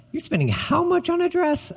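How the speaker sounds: mock disbelief. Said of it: "and I'm like".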